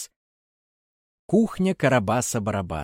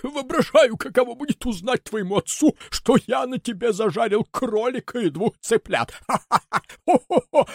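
high, laughing villain speech; through laughter; 12 semitones higher, 4 dB louder than usual. Acted. Karabas laughing at Buratino's father. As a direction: talk while laughing. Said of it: "Карабас сквозь смех".

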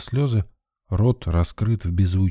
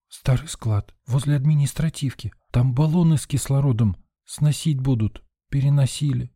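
put-on higher, fragile girl's voice; small dazed voice, pitched up; shocked young nurse; 6 semitones higher than usual. A narrator's reading. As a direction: report it weakly, in broken phrases. Shocked nurse explains to rescuer.

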